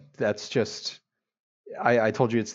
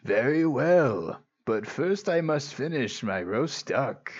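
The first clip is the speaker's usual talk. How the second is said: put-on wise old sage; 2 semitones higher, slower than usual. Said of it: "sounded like a wise old man thing".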